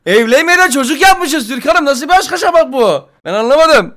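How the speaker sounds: high, loud disbelieving yelling; shocked outburst. Pretend. Gamer learns of a surprise baby, shocked yelling. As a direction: shriek in overblown shock.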